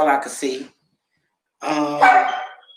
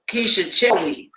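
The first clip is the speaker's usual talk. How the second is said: ordinary delivery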